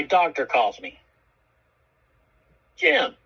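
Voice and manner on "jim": doctor's greeting. Retold or imitated. Doctor calls on phone.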